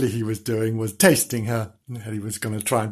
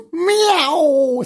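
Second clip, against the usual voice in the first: high, loud crying meow; kitten meow; 6 dB louder than usual. Acted. Bernard answering the children's call, loud high meow. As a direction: mew out like a kitten. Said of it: "said Bernard".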